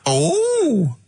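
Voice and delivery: very high, drawn-out camp hello; comedy catchphrase character. Acted. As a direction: swoop way up for a hammed-up character greeting. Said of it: "it's Dirty Old Queer".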